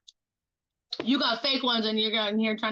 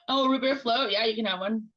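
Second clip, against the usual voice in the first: ordinary talk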